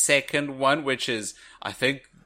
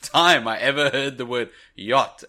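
normal talking voice

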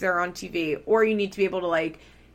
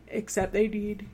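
mid-yawn; 5 dB quieter than usual. Real, not acted. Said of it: "oh, excuse me, a yawn just totally took me".

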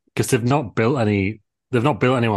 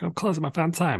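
high mocking quote; 10 semitones higher, 3 dB quieter than usual. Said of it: "She was like".

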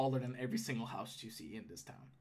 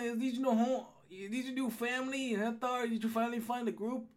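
nosy gnome guard, higher voice; gatekeeper gnome; 9 semitones higher than usual. Acted. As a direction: question an old acquaintance as the gnome guard.

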